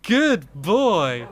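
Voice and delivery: high, loud doggy praise; praising a dog. Real, not acted. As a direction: praise him like a proud owner.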